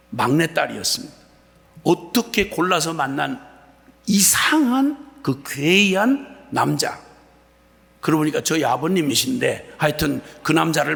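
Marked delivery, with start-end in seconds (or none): 4.0-7.0 slow, drawn-out, higher, with a changed tone; mock ominous. Real, not acted. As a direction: linger on 괴이한 as if describing a strange creature. Mother's strange husband, mocked. (느리고 과장된 으스스한 말투)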